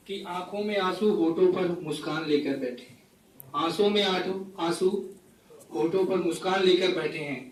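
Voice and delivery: phrase-by-phrase mushaira delivery; poetry recitation. Acted. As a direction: recite each couplet to the audience with pauses. Recites a poem to audience. (मुशायरे के अंदाज़ में कविता पाठ)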